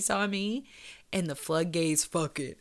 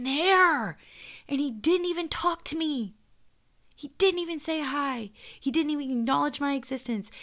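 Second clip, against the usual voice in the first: raised, stressing didn't each time; indignant; 8 semitones higher, 5 dB louder than usual. Real, not acted. Hurt woman recounting being ignored, indignant. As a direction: hit didn't with disbelief.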